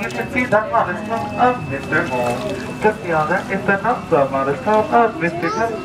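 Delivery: usual speaking voice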